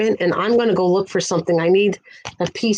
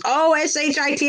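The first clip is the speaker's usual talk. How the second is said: imitating her; 8 semitones higher than usual. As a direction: lightly mimic the old lady spelling it out. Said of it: "She goes".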